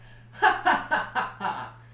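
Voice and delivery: big, loud belly laugh. Shown as a demonstration. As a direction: let out a huge laugh. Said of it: "a really big laugh".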